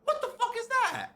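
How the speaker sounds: very high, squeaky mock shock; shocked exclamation. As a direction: squeal it in startled disbelief. Masseur finds a knot, retold.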